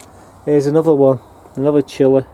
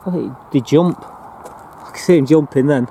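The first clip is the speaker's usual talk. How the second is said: usual speaking voice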